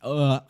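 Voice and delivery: loud annoyed groan; throat-clearing ugh. Real, not acted. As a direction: groan through a blocked throat. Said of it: "stuck in the back of my throat".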